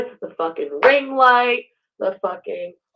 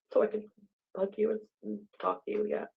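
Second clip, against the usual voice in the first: usual speaking voice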